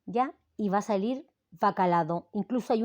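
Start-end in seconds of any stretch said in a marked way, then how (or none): 0.6-2.3 "bacalado" with an added D before the final o; the mistaken form "bacalado", extra D stressed; wrong "bacalado". Shown as a demonstration. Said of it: "le va a poner la D".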